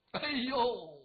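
usual speaking voice

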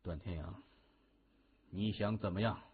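low, quiet self-control; composed. A narrator's reading. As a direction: ask coolly after regaining composure. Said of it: "从震惊当中沉静下来". (沉静低声地质问)

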